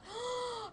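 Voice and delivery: high sharp gasp; excited gasp. Real, not acted. Fan gasping before gushing about the band, giddy gasp.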